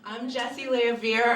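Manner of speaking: ordinary talk, nothing marked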